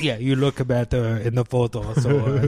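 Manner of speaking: badly faked Eastern European accent. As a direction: play along as a clumsy Eastern European photographer. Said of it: "we both suck at accents".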